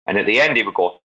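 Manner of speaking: usual speaking voice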